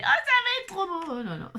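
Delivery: very high, gushing squeal; over-the-top delight. Pretend. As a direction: gush about it, hammed up and high. Enthousiasme surjoué pour son bouquet. (enthousiasme surjoué, très aigu)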